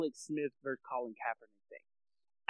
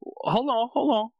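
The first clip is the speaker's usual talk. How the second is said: urgent interruption; 5 semitones higher, 3 dB louder than usual. Real, not acted. Interrupting before the sign-off.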